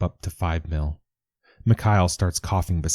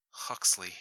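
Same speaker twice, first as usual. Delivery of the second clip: soft, drowsy murmur of a half-woken man; sleep-thick murmur; 11 dB quieter than usual. Acted. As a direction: say the name barely awake, very softly. Mikhail half asleep.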